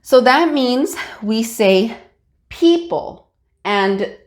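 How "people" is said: "people" with the first syllable stronger and higher; "people" said PEE-pul, high then falling; stress on first syllable. Shown as a demonstration. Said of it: "P is a little bit stronger".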